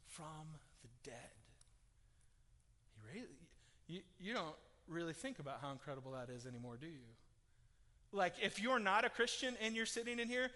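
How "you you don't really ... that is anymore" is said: usual speaking voice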